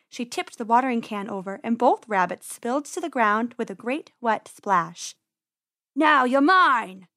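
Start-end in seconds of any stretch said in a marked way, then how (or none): 5.9-7.1 loud, slow, drawn-out menace; triumphant yell. A narrator's reading. Farmer finding the spilled rabbits, triumphant yell. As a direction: yell it slowly as you pounce. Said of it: "yelled Mister McGregor".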